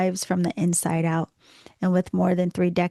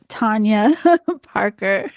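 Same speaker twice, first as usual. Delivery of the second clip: Tanya Parker said again through laughter, louder, the r in Parker spoken clearly; laughing correction; 3 semitones higher, 7 dB louder, slower than usual. Real, not acted. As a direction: correct the name while laughing at yourself. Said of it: "I'm sorry about that".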